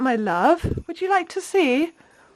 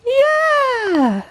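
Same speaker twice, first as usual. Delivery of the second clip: excited praise; 9 semitones higher than usual. Real, not acted. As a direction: cheer her on brightly. Praising toddler's answer.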